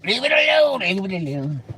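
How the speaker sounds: high, squeaky put-on voice, easing down; high mock plea. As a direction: squeal it high, then let it drop.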